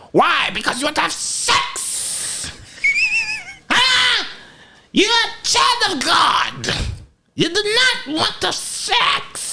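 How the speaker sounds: very high-pitched, drawn-out imitated shriek; hammed-up scolding in a squealing falsetto; mock outraged mother. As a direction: screech it slowly like a scandalized mother. Mother's outraged reaction, acted out.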